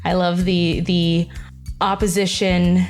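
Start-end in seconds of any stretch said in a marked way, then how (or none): none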